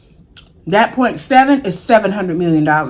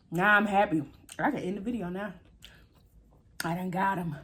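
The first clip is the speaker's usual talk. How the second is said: quiet; 15 dB quieter than usual. Real, not acted. Calm after the catch.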